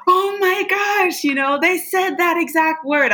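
high, amazed gasp of recognition; amazed client. Retold or imitated. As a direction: squeal it like a stunned believer. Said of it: "the person's like".

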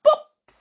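high, loud pop noise; popping sound. Shown as a demonstration. Storyteller to children, imitating the moon bursting. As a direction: make the moon burst with a sharp boop. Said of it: "and it going".

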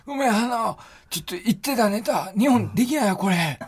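high, strained mock of a blown-out voice; imitating lost voice. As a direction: mimic your partner with no voice left. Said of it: "この声で、この声で、そう言ってたんです、あなた". (声が潰れた相方の真似)

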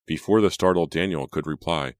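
ordinary delivery, nothing marked

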